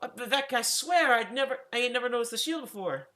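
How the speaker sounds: a startled maid insisting it was an accident; flustered housekeeper. Acted.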